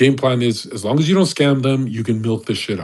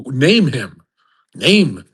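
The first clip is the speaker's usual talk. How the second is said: ordinary talk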